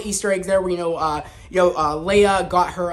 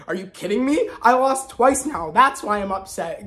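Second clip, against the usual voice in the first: mock Vader complaint; 3 semitones higher than usual. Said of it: "I would've died if he said".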